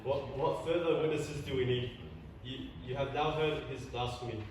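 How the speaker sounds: normal talking voice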